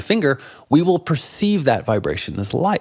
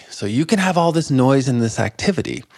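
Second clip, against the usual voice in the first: normal talking voice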